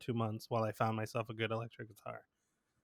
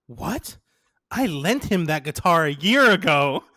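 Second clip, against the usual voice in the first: loud, raised retold complaint; indignant outburst; 4 semitones higher, 8 dB louder than usual. Teller imitates the real owner hearing of the gift, indignant.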